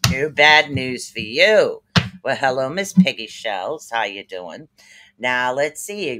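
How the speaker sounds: ordinary talk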